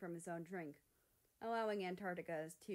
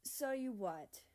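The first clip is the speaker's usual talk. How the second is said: pressing; 5 semitones higher, 3 dB louder than usual. Acted. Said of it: "Greenland pressed, glaring with disappointment".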